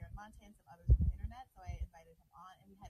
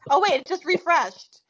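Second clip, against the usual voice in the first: exasperated and loud; fed up with the glitch; irritated; 5 semitones higher, 14 dB louder, slower than usual. Real, not acted. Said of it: "I'm so irritated".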